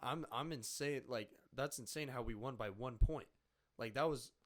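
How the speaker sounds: ordinary talk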